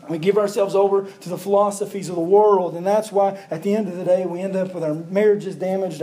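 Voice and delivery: rally them toward revival; full-force preaching. Acted.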